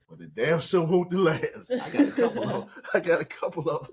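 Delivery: talking through laughter; words breaking into chuckles; real laughing. Real, not acted. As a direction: crack up while finishing the thought. Laughing at own story.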